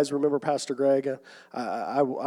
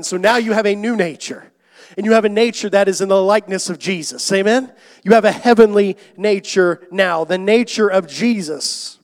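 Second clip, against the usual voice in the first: raised, louder preaching voice; preaching at full force; 4 semitones higher, 7 dB louder than usual. Acted. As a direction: preach it out to the congregation. Preacher builds energy.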